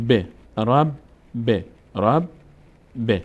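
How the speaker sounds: "ب" with short i vowel, alternating with closed "رب"; doubled letter, split. Shown as a demonstration. Teacher drills the twice-read b, syllables split by pauses. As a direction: sound out "رب", pause, "ب", separately.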